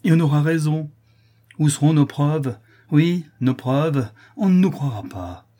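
loud, high, dragged-out bitterness; agitated despair; bitter outburst. Acted. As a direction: throw out each phrase in desperate scorn. Bitter despair after mad laugh.

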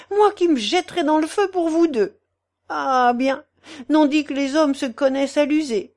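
voluble, high-pitched self-pity; aggrieved portress. Acted. Portress reproaching the two men she mothers, aggrieved. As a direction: protest devotion in a plaintive torrent.

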